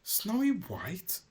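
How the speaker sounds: slow, high surprised question. Acted. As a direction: gasp out her name. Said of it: "he said with a surprise".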